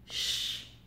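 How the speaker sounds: ch hissed alone, voiceless and soft; soft ch sound. Shown as a demonstration. Teacher to pupils, isolating the soft ch sound. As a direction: hold a quiet shushing ch. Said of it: "On entend".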